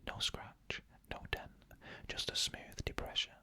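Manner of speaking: ordinary delivery, nothing marked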